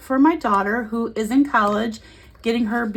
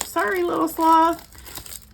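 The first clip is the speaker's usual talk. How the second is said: mock apology; 7 semitones higher, slower than usual. Real, not acted. Apologizing to sloth toy.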